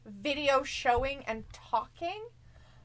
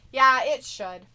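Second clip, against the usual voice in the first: ordinary delivery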